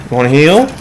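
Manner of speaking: cooing to a dog with swooping pitch; talking to a dog. Real, not acted. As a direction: coax him like a pet dog, pitch swooping upward. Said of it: "talk to him like he's a dog".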